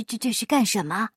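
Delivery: lightly voiced flustered old master; a hint of a confused miser; baffled master. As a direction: stammer it in puzzlement. Miser confused by barber's act.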